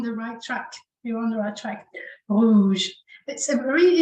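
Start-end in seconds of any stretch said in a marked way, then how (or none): 2.3-2.9 "Rouge" louder, with a subtle R in the throat; subtle French R. Shown as a demonstration. Teacher models for learners, "Rouge" with subtle throaty R. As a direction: say "Rouge" firmly, the R a small throat tickle, not rolled. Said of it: "it's subtle. It's not a big R sound".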